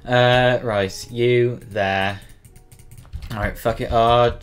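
normal talking voice

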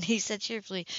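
usual speaking voice

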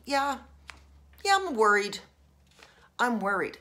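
ordinary talk

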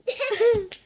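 short high laugh; burst of laughter. Real, not acted. Reader cracking up at the word, laughing. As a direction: laugh in surprise.

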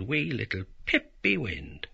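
ordinary delivery, nothing marked

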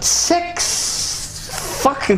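drawn-out, high-pitched disbelief; slow build into an exasperated yell; incredulous outburst. Real, not acted. Co-host baffled by six controls on one controller, incredulous.

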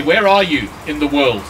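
usual speaking voice

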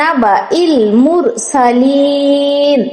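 মুরসালিন recited very slowly with সালি stretched three alifs; তিন আলিফ টান. Shown as a demonstration. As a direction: chant it, holding সালিন long. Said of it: "তিন আলিফ টান দিবেন". (সালিনে তিন আলিফ টেনে তিলাওয়াত)

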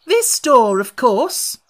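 loud, high irritated retort. A narrator's reading. Alice, irritated at the frog, snapping loudly.

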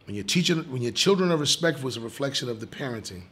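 ordinary talk

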